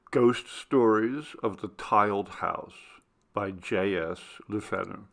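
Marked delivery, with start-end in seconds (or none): none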